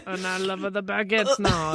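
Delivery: mock French accent, playing along; badly faked French; horrible French accent. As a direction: ham up a cheesy French accent. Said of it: "horrible French accents".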